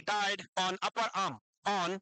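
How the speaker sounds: very slow, word by word; slow dictation. Shown as a demonstration. Teacher dictating notes to students, very slow. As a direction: dictate so they can write along. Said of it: "अभी मैं रुक रुक के बोलूंगा".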